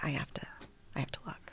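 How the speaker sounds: faint whisper, forcing herself; scared whisper. Retold or imitated. Forcing herself to open the door, hushed whisper. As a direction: whisper it, bracing yourself.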